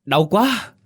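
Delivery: high sharp cry of pain; sudden yelp of pain. A narrator's reading. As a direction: cry out as the face hits the stones. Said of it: "làm cô kêu thét thấu trời".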